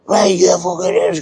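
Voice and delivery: high, drawn-out mock scream; imitated screaming. Steward laying into Andy, mock screaming. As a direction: mimic him screaming, without full volume. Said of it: "starts just screaming".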